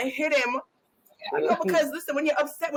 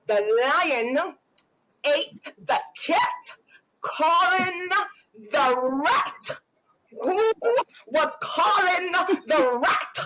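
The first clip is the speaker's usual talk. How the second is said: slow, higher, menacing word-by-word correction; mock-enraged parent spelling out every word; hammed-up wrath, each word drawn out; demonic homework fury; 4 semitones higher, much slower than usual. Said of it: "a demonic spirit just come over me".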